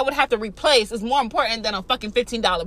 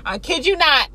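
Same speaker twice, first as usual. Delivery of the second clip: ordinary talk, nothing marked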